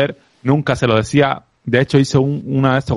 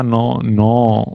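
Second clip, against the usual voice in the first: ordinary talk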